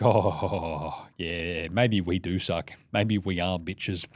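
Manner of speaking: low, soft, slow imitation of chastened refs; mock sheepish admission. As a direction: mumble a reluctant mock concession, low and drawn out. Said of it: "those refs are just gonna be like".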